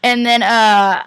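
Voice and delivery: ordinary delivery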